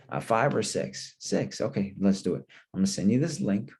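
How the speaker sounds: ordinary delivery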